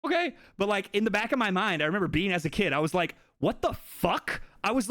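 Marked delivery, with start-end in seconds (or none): 0.1-0.3 high, overly bright fake acceptance; mock cheerful okay, much higher; forced okay. Faking calm acceptance. 3.4-4.4 slow, high appalled what the fuck; imitating a horrified kid, slow and higher.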